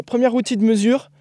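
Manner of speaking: ordinary talk, nothing marked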